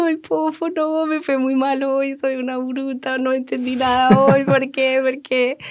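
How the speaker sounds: high, whiny mock-crying lament; slow, whimpering, self-pitying retelling; tearful whine. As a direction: replay the tearful complaint to her husband, a bit hammed-up. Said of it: "yo salí llorando y yo".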